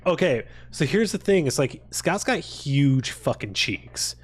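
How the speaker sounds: normal talking voice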